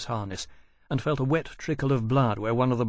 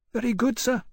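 put-on young officer; 12 semitones higher than usual. A narrator's reading. Officer's reply.

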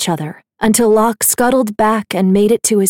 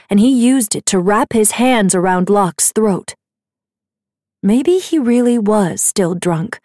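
normal talking voice

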